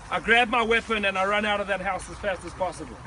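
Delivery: ordinary talk, nothing marked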